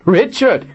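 high, cruel mocking laugh; mocking villain laugh. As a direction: scoff at the name, then cackle. Villain mocks the dead hero.

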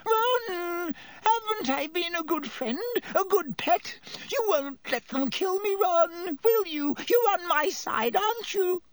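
high, squeaky wheedling plea; desperate begging. A narrator's reading. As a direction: plead for your life in a high voice. Pettigrew begs Ron to save him.

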